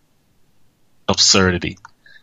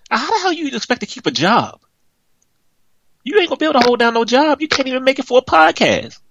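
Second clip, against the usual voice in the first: high-pitched fast ranting at an absent co-host; angry rant. Real, not acted. Host venting at his late co-host, high furious rant. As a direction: let the outrage spiral up high and quick. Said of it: "I'm so mad I can't see straight".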